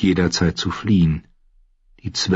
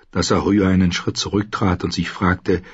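ordinary talk

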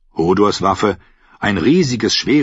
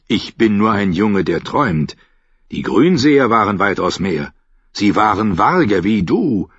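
usual speaking voice

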